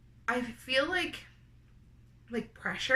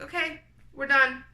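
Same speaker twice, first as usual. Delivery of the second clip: doctor's voice; 5 semitones higher, 5 dB louder than usual. Retold or imitated. Doctor abruptly ending the procedure.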